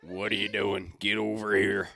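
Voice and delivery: lightly voiced cop calling someone over; police officer. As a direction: order them over like a cop. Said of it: "Like some fucking police follower".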